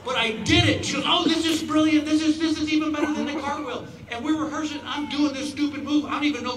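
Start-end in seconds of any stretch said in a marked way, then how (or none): 1.1-3.9 dance partner thrilled with the trick; delighted Emma. Retold or imitated. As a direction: gush over the new move. Emma delighted, high loud.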